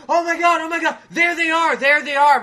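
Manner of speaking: high, loud crowd cries; imitated excited crowd. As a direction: mimic fans shouting in a high, loud voice.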